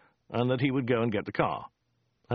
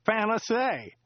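high, chatty local driver voice; a rambling cab driver, pitched up; chatty driver Joe; 10 semitones higher, slower than usual. Acted. Driver Joe chatting to Dirk on the drive, high chatty voice. Joe's voice again.

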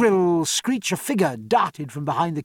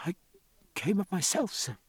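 put-on small, soft, faltering girl's voice; trembling voice; 3 semitones higher, 8 dB quieter than usual. A narrator's reading. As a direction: answer timidly, hesitating after the first word. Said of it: "said the girl in a trembling voice".

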